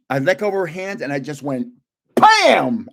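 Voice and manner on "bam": loud, sharp "Bam!" sound effect; punchy shouted smack noise; mock slap sound. Imitating the slap he gave.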